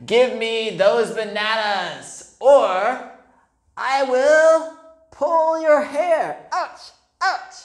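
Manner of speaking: high, loud cheeky monkey threat; threatening monkey. A narrator's reading. Monkey demanding bananas from Stephen, high and loud. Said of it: "The monkey said".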